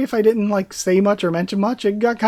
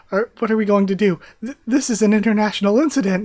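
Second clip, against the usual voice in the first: fast, laughing, higher panic; panicked laughing fluster; 4 semitones higher, 5 dB louder, faster than usual. Acted. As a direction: babble in giggling alarm. Guards whispering in panic.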